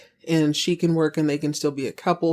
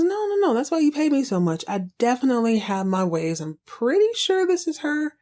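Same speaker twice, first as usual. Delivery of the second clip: higher, eager and sure of himself; eager detective; 6 semitones higher than usual. Retold or imitated. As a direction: sell it like a proud private eye. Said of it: "He goes".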